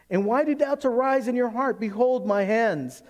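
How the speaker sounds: high, loud proclamation; preached scripture reading. Acted. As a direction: call it out from the pulpit, slowing at the end. Reads scripture with preaching force.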